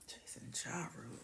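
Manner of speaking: breathy whispered name. Pretend. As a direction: whisper it like a seductive intro. Savoring viewer's name.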